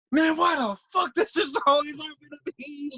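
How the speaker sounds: put-on high-pitched, tearful drunk tantrum; mad whining. Drunk guy cursing his bad luck, whining mad. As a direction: wail it in hammed-up self-pity. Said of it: "I'm mad".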